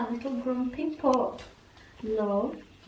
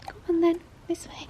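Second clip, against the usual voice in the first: ordinary talk, nothing marked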